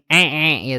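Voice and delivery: "e" squeezed a little in the throat; "e" with a small pharyngeal squeeze; pressed ain. Shown as a demonstration. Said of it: "dia agak penekanan sedikit".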